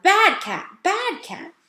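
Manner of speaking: high, loud scolding; shouting scold. A narrator's reading. Girl shouting at the cat by the lodges. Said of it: "Lana shouted".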